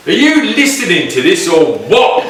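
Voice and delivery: loud, high Scottish heckle at the crowd; Scottish accent. Retold or imitated. A Scottish performer scolding a chatting festival audience, Scottish accent. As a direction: bellow it in a broad Scottish accent. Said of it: "with this big Scottish accent".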